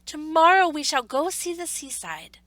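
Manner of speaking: bursting with anticipation; excited. A narrator's reading. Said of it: "thought the little rabbit".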